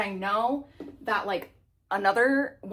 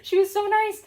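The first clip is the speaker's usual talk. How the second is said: gushing, much higher and louder; excited; 12 semitones higher, 6 dB louder than usual. Real, not acted. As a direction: squeal it with delight. Gushing about a kind friend.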